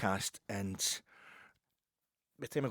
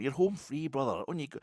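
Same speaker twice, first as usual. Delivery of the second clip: higher, insistent quoted send-off; Nate on the phone; 6 semitones higher, 5 dB louder than usual. Retold or imitated. Nate sending the driver off by phone, raised quote. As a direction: urge him on, pitch lifted. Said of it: "says Nate down the phone".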